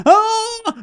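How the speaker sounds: put-on a hurt kid's short scream of panic; child's high panicked scream. Little boy seeing his own blood, panicked high scream. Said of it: "I'm like".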